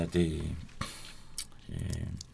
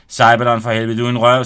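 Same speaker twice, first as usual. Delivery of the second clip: mock anger; 10 dB louder than usual. Benny scolds boastful friend.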